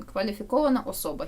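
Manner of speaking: ordinary delivery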